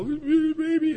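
tiny high-pitched squeak on the word; mock gushing, voice shooting way up; gushing baby squeal. Gushing over the baby show.

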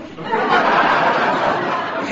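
breathy laugh; short open laugh; laughter. Real, not acted. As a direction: let a laugh out. Laughter after punchline.

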